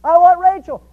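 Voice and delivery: high, loud acted indignation; outraged protest. Deceived Jacob confronts his father-in-law, loudly indignant. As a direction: confront the cheat, building to a loud demand.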